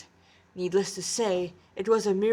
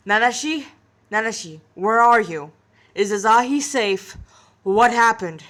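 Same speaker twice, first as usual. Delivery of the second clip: louder, pressing string of questions; urgent calling; 7 dB louder than usual. Acted. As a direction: fire off the questions, desperate for an answer. Boss panicking over the phone.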